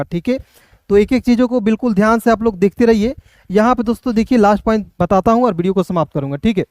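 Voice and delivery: usual speaking voice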